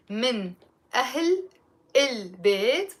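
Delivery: "من" "أهل" "البيت" each separated by pauses; slow, word by word. Shown as a demonstration. Teacher slowing the phrase for learners, word by word. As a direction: break it into single words slowly. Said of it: "say it slowly".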